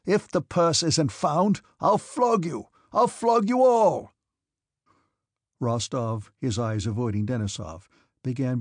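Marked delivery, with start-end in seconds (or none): 0.1-4.1 high, slow, shouted threat; furious threat. A narrator's reading. Officer threatens the orderly with flogging, shouting furiously. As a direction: build to a loud, drawn-out roar.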